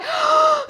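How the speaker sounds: high acted gasp; sudden high-pitched mock gasp; shocked gasp. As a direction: mimic her horrified intake of breath. Imitates her shocked reaction.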